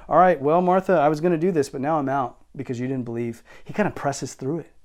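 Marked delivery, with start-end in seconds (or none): 0.1-3.4 a hint of sulking quitter, louder start; lightly hammed-up huffy Jesus; mock huffy. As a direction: play a petulant walk-off, half-joking. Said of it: "He's not like".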